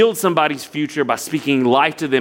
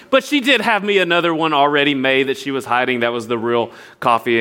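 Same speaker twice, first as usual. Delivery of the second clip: ordinary talk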